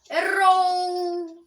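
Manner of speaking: loud triumphant high shout; gloating shout. Real, not acted. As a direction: gloat loudly at the miss. Enemy missed, gloating.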